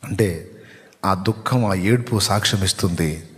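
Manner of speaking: low, subdued pastoral voice; intimate preaching. Real, not acted. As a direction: speak quietly to one grieving listener's heart. Preacher speaks intimately of weeping.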